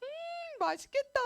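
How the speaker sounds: high mmm then a loud, eager yum; hungry delight. Acted. Hungry Israelites seeing the quail, eager mock delight. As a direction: savour it like a hungry kid.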